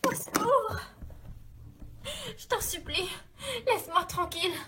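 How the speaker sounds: high, frightened cry for help; terrified pleading. Acted. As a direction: beg for mercy in panic. Victim begs attacker to stop.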